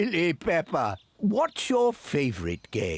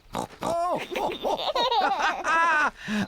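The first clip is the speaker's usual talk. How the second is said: long, high delighted laugh. Real, not acted. Grandpa playing worm with the children, a long high laugh. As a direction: laugh from the belly with glee.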